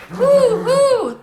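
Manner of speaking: high, loud owl hoot. A narrator's reading. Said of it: "the voice called again".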